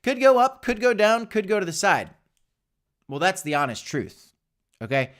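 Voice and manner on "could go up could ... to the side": mock quote of himself. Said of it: "Some people get frustrated when I say".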